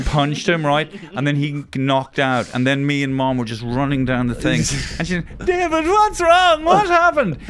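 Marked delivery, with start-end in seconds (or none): 5.4-7.4 very high, loud mock-mother shriek; drawn-out high comic wail; panicked mother. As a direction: cry out as an alarmed mum, hammed up. Retold panicked mother calling out.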